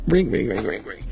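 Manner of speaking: fading ring, ring; imitated ringing phone; phone ring imitation. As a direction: mimic a ringing phone, dying away.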